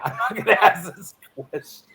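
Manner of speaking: laughing through the line. Real, not acted. Laughing as he recalls backing off the question.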